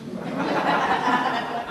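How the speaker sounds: quiet breathy chuckle; soft laugh. Real, not acted. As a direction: laugh softly. Laughs at wild parents.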